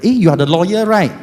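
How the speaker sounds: loud, high excited recognition; recognising stranger. Retold or imitated. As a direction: call out spotting a celebrity. Voicing people recognising him.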